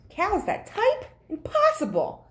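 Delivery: high, astonished squeak of disbelief; can't believe it, voice jumps high. Acted. Farmer reading the note, high astonished disbelief.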